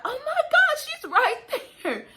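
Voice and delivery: very high excited squeal, loud at the start; astonished cry, imitated. Mom seeing the baby coming, imitated astonished squeal. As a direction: exclaim in acted amazement, stretching the end.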